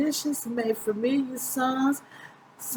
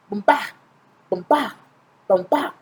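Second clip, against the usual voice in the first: conga beat; 2 semitones lower, 7 dB louder than usual. Acted. Narrator imitates conga beat.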